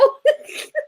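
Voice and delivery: very high, tearful laugh; crying with laughter. Real, not acted. Streamer overcome by a chat comment, crying with laughter. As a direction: let out a squeaky, helpless real laugh. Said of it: "I'm crying now".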